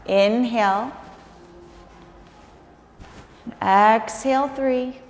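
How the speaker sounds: slow, calm counting of breaths. Acted. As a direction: count each breath out, unhurried. Yoga teacher counts held breaths.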